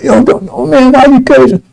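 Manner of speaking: put-on pleading protest; mock protest. Acts out an objector to cursing.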